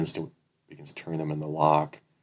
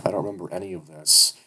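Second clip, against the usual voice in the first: ordinary delivery, nothing marked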